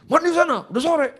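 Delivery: high, loud mock scolding; snappish father. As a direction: imitate a dad barking a high-pitched order. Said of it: "Dia kalau ngomong nyolot begitu".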